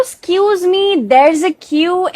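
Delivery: drawn-out polite excuse me; wrong take. Shown as a demonstration. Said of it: "ऐसा नहीं कहना है".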